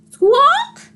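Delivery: very high, loud squawk; chicken squawk. Acted. Chicken hops on with a very high loud squawk. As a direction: screech like a hen. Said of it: "said Chicken".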